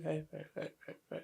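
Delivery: muted, soft mumbled hellos; awkward greeting. Retold or imitated. Awkward relatives greeting each other at a gathering, muted. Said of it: "my family's very awkward".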